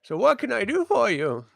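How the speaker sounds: put-on high-pitched old shopkeeper; high character voice serving a customer; Cole, old alchemist. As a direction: offer help in a high old-man voice. Game master resumes alchemist's voice.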